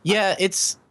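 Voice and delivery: ordinary delivery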